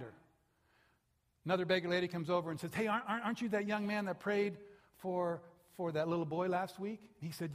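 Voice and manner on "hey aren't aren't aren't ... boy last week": eager call. Retold or imitated. Beggar lady calls out excitedly.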